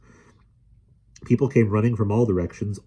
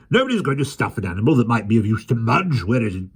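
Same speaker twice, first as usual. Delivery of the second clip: loud demanding; 4 semitones higher, 5 dB louder than usual. A narrator's reading. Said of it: "he demanded loudly".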